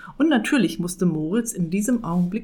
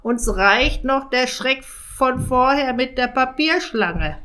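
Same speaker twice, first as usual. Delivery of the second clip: rattled raven; 6 semitones higher, 5 dB louder than usual. Acted.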